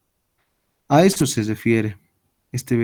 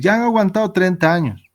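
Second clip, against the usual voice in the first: higher, louder urging; exhorting apostle; 7 semitones higher, 3 dB louder than usual. Acted. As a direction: press them to hold on.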